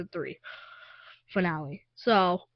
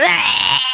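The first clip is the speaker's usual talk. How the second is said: loud, raised roar of pain; monster cry; 4 semitones higher, 10 dB louder than usual. Retold or imitated. Child acting the beholder on fire, loud mock roar. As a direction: roar like a burning beast. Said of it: "it was going".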